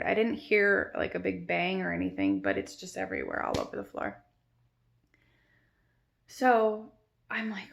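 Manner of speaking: ordinary talk, nothing marked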